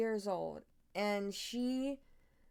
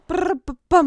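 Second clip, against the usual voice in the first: loud lip trill; 7 semitones higher, 19 dB louder than usual. Shown as a demonstration. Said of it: "How does that sound?".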